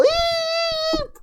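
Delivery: high loud squeak of a startled monkey; surprised eep. Acted. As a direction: squeal as if grabbed by the hair. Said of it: "He just go".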